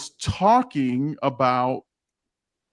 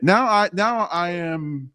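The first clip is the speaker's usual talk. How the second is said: ordinary talk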